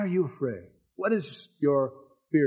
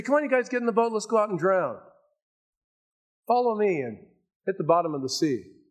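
much higher, hammed-up cheerful call; mock Jesus; 6 semitones higher than usual. Preacher mocking a wrong version of Jesus' words, high and cheerful. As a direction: parody a reckless leader urging doom. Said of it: "Jesus didn't say".

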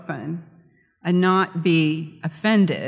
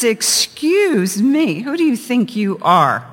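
indignant; 5 semitones higher, 5 dB louder, slower than usual. Retold or imitated. Insulted lady confronts the parrot.

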